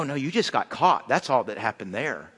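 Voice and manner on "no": ordinary talk, nothing marked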